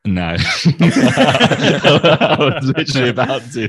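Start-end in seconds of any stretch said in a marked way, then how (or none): 0.4-3.7 laughing. Real, not acted. Laughing after being put on spot.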